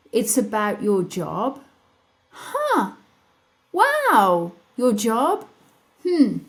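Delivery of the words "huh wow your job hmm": higher, louder, playfully puzzled; mock curious surprise. Coaching listeners to question the shoulder, mock intrigued. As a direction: react like you just noticed something odd. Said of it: "If your shoulder is saying to you".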